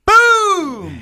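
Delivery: loud, high shouted boom; mock gunshot boom. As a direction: blast it out like a shotgun. Acting out gunshots.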